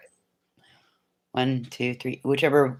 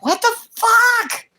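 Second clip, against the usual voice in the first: much higher, louder retold wake-up squeal; high, louder groggy alarm; startled self; 4 dB louder, slower than usual. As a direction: woken in alarm at three o'clock. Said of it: "I'm like".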